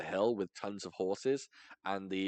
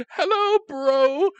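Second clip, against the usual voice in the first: silly greeting; 11 dB louder, much slower than usual. Retold or imitated. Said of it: "I would go".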